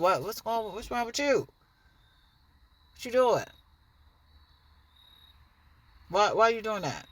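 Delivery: demanding answers from someone attacking you; confronting. Retold or imitated. Acted confrontation, victim calling out attacker.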